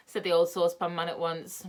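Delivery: usual speaking voice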